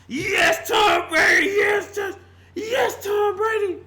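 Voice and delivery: high, loud, near-crying fan cheer; mock worship cheer. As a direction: gush over him like a starstruck fan. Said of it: "Cheering and worshiping Tom Brady".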